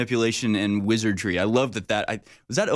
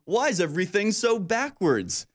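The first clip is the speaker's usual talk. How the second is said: raised pitch in mock bewilderment; mock socialist candidate's complaint; 7 semitones higher than usual. Skit candidate wondering about the world, mock-bewildered. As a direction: plead cluelessly, voice pitched up. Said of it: "and on the other hand going".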